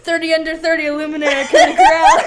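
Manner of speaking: laughing boast. Real, not acted. Bragging to his cohost about breaking YouTube, laughing loudly. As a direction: crow it out through laughter.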